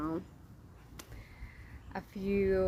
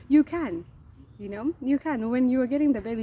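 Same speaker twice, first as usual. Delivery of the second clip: imitated nurse, higher and louder; insistent retort; 4 semitones higher, 8 dB louder than usual. Head nurse insists to reluctant patient that she can, insistent retort. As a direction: act out a nurse firmly overruling a patient.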